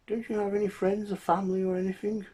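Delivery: put-on very high, quick old man voice; high-pitched, loud questioning old fellow; blind old man.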